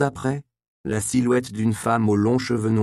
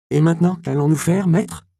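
higher, louder anxious question; worried; 7 semitones higher, 5 dB louder than usual. A narrator's reading. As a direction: Robin frets about what comes next. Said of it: "demanda Robin, inquiet". (Robin inquiet, voix plus aiguë et plus forte)

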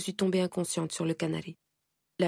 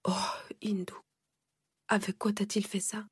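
shocked whisper; 4 semitones higher, 5 dB quieter than usual. Acted. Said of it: "un cri de stupeur".

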